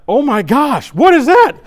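shocked shout. Retold or imitated. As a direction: yelp at seeing the baby already there. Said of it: "I was like".

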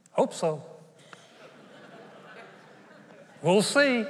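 mock doubtful answer; hedging, unsure mock reply; noncommittal. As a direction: shrug it off, half-hearted. Acts out a doubtful vow answer.

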